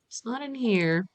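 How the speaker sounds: ordinary talk, nothing marked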